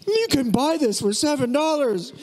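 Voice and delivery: high, astonished voice of a shopper abroad; amazed foreign visitor. Retold or imitated. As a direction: gasp at the price like a stunned tourist. Visitors amazed at American portions.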